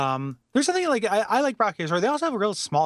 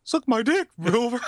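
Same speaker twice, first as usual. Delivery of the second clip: rude talking horse voice; 8 semitones higher than usual. Pretend. Mister Ed heckles from bushes.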